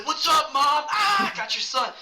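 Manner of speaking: high, loud taunting yell; taunting shout. Retold or imitated. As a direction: jeer at the other team's mom. Kid taunting opposing mom.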